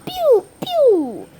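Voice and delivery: loud, very high zaps sliding down; high mouth-made pew noises; imitated pew pews. Shown as a demonstration. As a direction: squeal out toy laser shots. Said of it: "booms and pew pews and smaller explosions".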